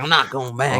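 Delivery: Southern-accented mock protest. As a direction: mimic a defiant Southern man. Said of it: "He has a real Southern accent".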